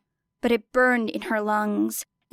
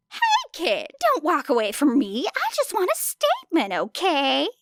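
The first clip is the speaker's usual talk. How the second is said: pushy reporter; 6 semitones higher than usual. A narrator's reading. Reporter grabbing at the girl.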